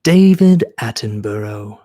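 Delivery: ordinary talk, nothing marked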